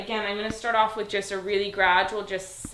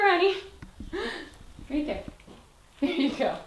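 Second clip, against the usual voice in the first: high coaxing; 8 semitones higher than usual. Real, not acted. Trainer coaxing her dog toward a treat. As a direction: call her sweetly up high.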